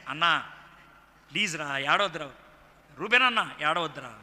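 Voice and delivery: acted loving comfort. Joseph begging his weeping brothers not to cry, lovingly. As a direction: gently plead as a loving brother. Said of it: "ఆ విధంగా ప్రేమగా మాట్లాడాడు".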